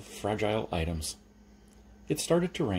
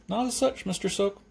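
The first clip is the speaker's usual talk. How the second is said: awkward polite refusal; 11 semitones higher than usual. Acted. Customer politely declining again.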